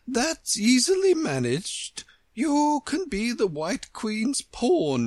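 pleasantly grand, stretched vowels, smiling; pleasant Queen. A narrator's reading. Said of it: "only smiled pleasantly and said".

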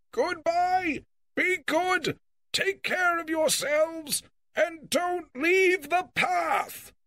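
high, slow distant call; calling from afar. Acted. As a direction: call out as he rides off. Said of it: "put his hands to his mouth and called to them".

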